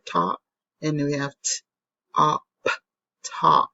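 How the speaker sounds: "t-, op, top" split into sounds then blended; sounding out a word. Shown as a demonstration. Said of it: "So we have the ah sound".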